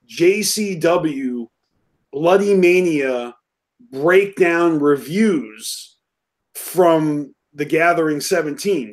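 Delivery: spelled out slowly for listeners; each word slowly and carefully; slow and clear. Real, not acted. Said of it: "repeat what you said in case people didn't catch".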